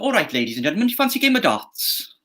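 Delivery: Welsh lilt climbing high on the question; Welsh accent. Shown as a demonstration. Games host inviting a crowd to darts, Welsh accent.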